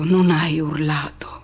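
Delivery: slow, breathy whisper; slow it down and drop to a whisper. Acted. Remembering the lover who did not cry out, slow whisper. Hushed recall of the scene.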